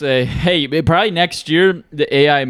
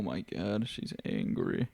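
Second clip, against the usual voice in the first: hushed whisper, much softer; 5 semitones lower, 17 dB quieter, slower than usual. Real, not acted. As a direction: whisper so the phone won't hear. Afraid to upset Siri nearby.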